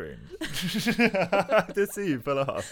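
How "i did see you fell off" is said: high, loud, laughing through the words; much higher, louder real laughing tease. Real, not acted. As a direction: laugh as you tease her. Teasing while laughing.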